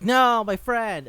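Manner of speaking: slow, high and loud wail; anguished cry. Retold or imitated. Lion cries out over his fainted friend, slow loud wail. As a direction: mourn the fallen friend out loud.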